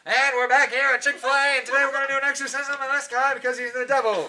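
put-on loud, fast old-time ballgame call; 1940s baseball announcer. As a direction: call it like an old radio broadcaster. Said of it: "talk like nineteen forties baseball announcers".

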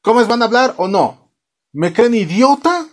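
high, indignant questions, hammed-up; mock angry demand. Father demands answers from his children, mock indignant. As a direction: play an exasperated dad's interrogation.

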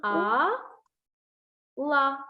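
А held alone, a long pause, then ла; sounding out А ... ла to show the first sound; split syllables. Shown as a demonstration. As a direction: break Алла into А and ла. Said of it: "На початку чуємо звук А".

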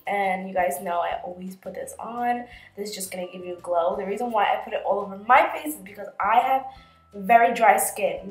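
ordinary talk

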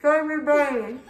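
laughing through a stuffed mouth; cheeks stuffed full. Real, not acted. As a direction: giggle round a mouthful.

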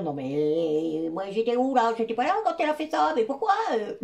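high, fast squeaky cartoon voice; cartoon ego voice. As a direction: play the whining ego as a cartoon. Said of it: "cette petite voix, euh, de dessin animé".

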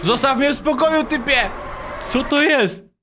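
high-pitched alarmed yelling; panicked shout. Real, not acted. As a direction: yell at the thing to back off. Scare in game, yells at creature.

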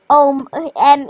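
ordinary talk, nothing marked

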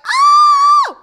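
piercing high scream; real scream. Real, not acted. Mom losing it at the Yahtzee, screaming. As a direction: let out a full frustrated scream. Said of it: "I just screamed into the microphone".

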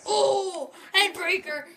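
loud, high ringside cry; excited shout. Real, not acted. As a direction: call out the big move. Reacts to the fight.

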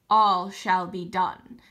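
slow, loud, low lion king promise; solemn lion's slow vow; deep, rich lion voice. A narrator's reading. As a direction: pronounce it slowly like a great lion. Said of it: "His voice was deep and rich".

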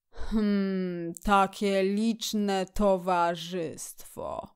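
slow, drawn-out grumble; grumbling murmur. A narrator's reading. Badger sees too much company, grumbling. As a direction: grumble to yourself, reluctant. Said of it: "Mruknął".

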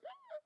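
thin, very high wheeze; strained high inhale; imitated choking gasp. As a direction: fight for air in a squeaky, soft gasp. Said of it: "That's what it sounded like".

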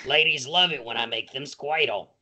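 put-on raspy old lady who smokes; old smoker lady. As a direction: play a chain-smoking granny. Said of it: "continues old smoker bit".

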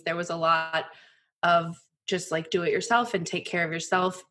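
usual speaking voice

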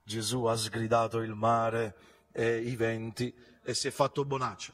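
usual speaking voice

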